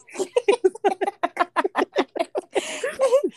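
long high-pitched laugh; real high laughter. Real, not acted. Laughing during the story.

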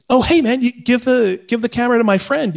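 high, casual imitated asking; retold eager request.

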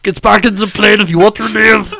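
high, loud mock Austrian action-hero accent; Arnold. Comic answering the Terminator bit, loud Austrian-accented impression. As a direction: shout it as a hammy Austrian cyborg. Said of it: "is an Arnold impression".

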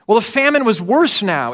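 usual speaking voice

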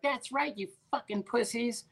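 high-pitched mocking female taunt; put-on high, taunting voice; mouthy sister firing off. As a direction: imitate a sister jeering from the back seat. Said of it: "she fires off".

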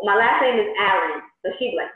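ordinary talk, nothing marked

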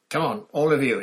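loud, high command; brisk loud order. A narrator's reading. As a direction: order them out. Snape ordering them out.

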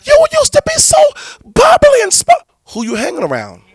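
shocked high squeal. Retold or imitated. Pastor mocking the church's shocked reaction. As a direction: squeal it out like you can't believe it. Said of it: "we're gonna be like".

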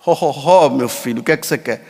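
a kindly genie asking a wish; genie God. Retold or imitated. Priest joking God answers like a genie, low jolly voice.

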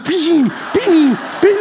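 high ringing gunshot imitation; ping out each little shot; imitated BB gun pings. Little brother firing a BB gun, imitated pings.

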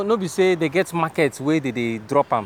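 casual Pidgin question; Nigerian Pidgin. Real, not acted. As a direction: ask it in Naija Pidgin. Switches to pidgin with worker.

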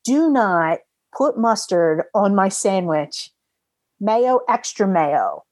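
slow, deliberate instructions; careful instructions. Retold or imitated. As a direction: spell it out slowly for the clerk. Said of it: "I said real carefully".

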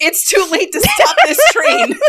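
high words through laughter; laughing. Real, not acted. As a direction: giggle through the line. Laughing while talking.

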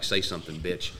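low, hushed taunt; retold whisper. J C getting up on the fraud, hushed menacing taunt. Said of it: "I start whispering at him".

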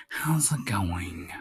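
mildly hammed-up whispery polite voice. Podcaster mocking his cuzzies with a whispered patronizing voice.

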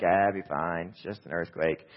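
low, dismissive reassurance; calm, unbothered. Retold or imitated. Husband to his panicking wife during an earthquake, unbothered. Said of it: "keep a calm, cool demeanor".

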